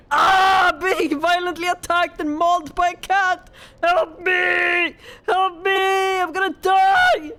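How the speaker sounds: loud, hammed-up wail of doom; mock cry for help. Cat attacks the owner, hammed-up screaming for help. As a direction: holler as if mauled, over the top.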